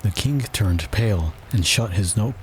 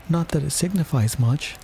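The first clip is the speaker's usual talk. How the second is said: put-on high-pitched girl talking to herself; higher-voiced private musing; Alice; 7 semitones higher than usual. A narrator's reading. Alice talking to herself in court, high musing voice. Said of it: "she said to herself".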